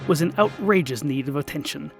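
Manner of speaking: plain reading voice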